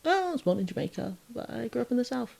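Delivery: offhand reply. Retold or imitated. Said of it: "I'm like".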